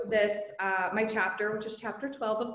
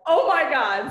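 surprised; 5 semitones higher, 8 dB louder than usual. Retold or imitated. Stunned by the sudden news.

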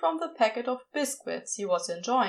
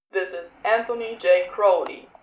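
lazy drawl; 4 semitones lower, 6 dB louder than usual. A narrator's reading. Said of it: "the voice drawled through the radio".

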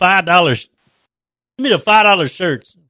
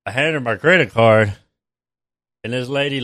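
ordinary talk

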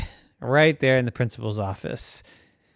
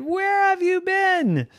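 astonished; slower than usual. Acted.

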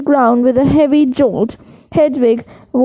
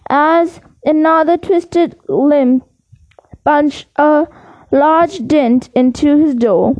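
usual speaking voice

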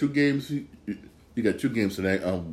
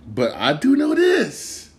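emphatic; 13 semitones higher, 6 dB louder, slower than usual. Real, not acted. Hockey fan boasts after admitting ignorance.

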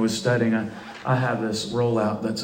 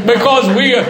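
high, driving preacher's proclamation; full-force preaching; 7 semitones higher, 6 dB louder than usual. Real, not acted. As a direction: build excitement in the congregation with raised voice.

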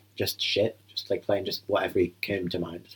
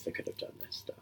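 whispering; 16 dB quieter than usual. Real, not acted. Muttered aside during film.

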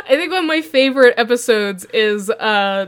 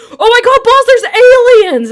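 much higher, louder mock panic; panicked thug; 11 semitones higher, 9 dB louder than usual. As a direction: shriek the news at the boss. Thugs panic about aliens.

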